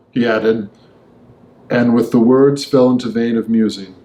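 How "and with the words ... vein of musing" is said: usual speaking voice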